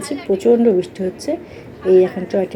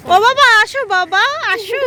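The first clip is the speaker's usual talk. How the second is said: calling out; 14 semitones higher, 4 dB louder than usual. Real, not acted. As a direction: call the little one over, coaxing. Calling a child to come.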